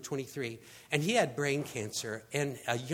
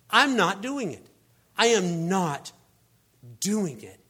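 "not" hit loud, each phrase set apart; emphatic refusal; 2 semitones higher, 6 dB louder than usual. Retold or imitated. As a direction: refuse firmly, leaning hard on "not". Acting out Jesus's firm refusal.

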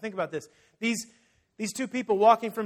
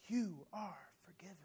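whispered forgiveness; 13 dB quieter than usual. Retold or imitated. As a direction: grant pardon in a whisper. Voicing Jesus forgiving.

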